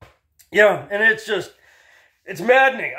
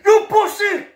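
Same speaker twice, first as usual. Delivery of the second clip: much higher, louder taunt over the shoulder; taunting yell; 5 dB louder than usual. Retold or imitated. Kid fleeing my brother, high loud taunting yell. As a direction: yell back at him while running away. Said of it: "yelling at my brother".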